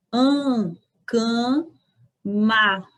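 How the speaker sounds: "can" nasal, split into syllables. Shown as a demonstration. Teacher splitting cama to show the nasal a. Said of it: "se pronuncia nasalizado".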